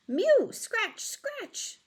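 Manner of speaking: high, loud scratchy cat mew, then fading; cat scuffling and mewing. Acted. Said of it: "scuffed, scuffled Simpkin".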